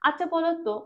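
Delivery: usual speaking voice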